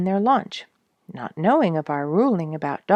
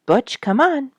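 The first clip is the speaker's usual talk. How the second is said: raised calling voice, higher; calling the dog; 9 semitones higher, 4 dB louder than usual. A narrator's reading. Owners shout for their dog, higher calling voice. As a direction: call the dog back urgently.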